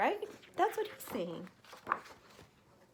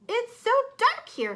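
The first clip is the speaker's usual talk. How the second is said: higher, louder worry; uneasy in the dark; 12 semitones higher, 8 dB louder than usual. Acted. Hermit Crab lost in the dark seaweed, worried. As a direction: fret about the gloom. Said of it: "thought Hermit Crab".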